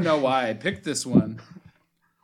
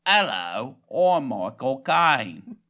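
loud, nasal Cockney attempt, last words dragged out; exaggerated, nasal British star impression; nasal Michael Caine; 5 dB louder, much slower than usual. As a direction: push a London actor voice up into the nose. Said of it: "go a little more nasally".

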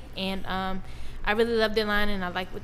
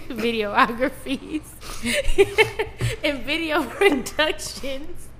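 trying not to laugh; 4 semitones higher, slower than usual. Real, not acted. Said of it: "I'm trying not to laugh right now".

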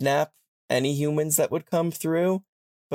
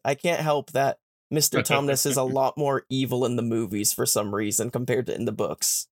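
ordinary talk, nothing marked

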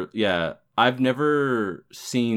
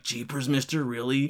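put-on wholesome 1950s kid; 4 semitones higher, 4 dB quieter than usual. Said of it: "whichever the blue one is, is like".

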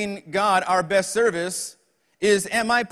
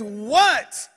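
loud, raised "What?"; exasperated mom; 3 semitones higher, 4 dB louder than usual. Acted. Mom answers her boy's bedtime call, exasperated and loud. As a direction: answer like a fed-up mother.

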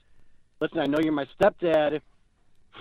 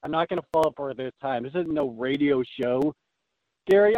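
ordinary talk

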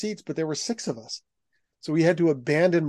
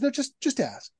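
nervous mom; 11 semitones higher than usual. Retold or imitated. Mother nervous in New York, urging her son to ask, higher worried voice. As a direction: play the anxious mom, pitched up.

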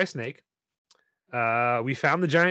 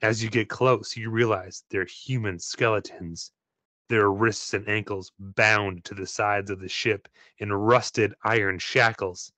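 usual speaking voice

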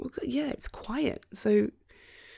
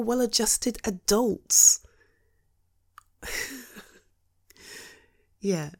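usual speaking voice

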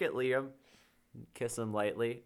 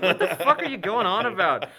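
incredulous; 7 semitones higher, 9 dB louder than usual. Retold or imitated. Baffled reaction to the rapping.

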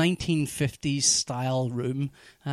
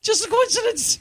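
mock emphasis; 3 dB louder than usual. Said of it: "It's the kind of show this is".

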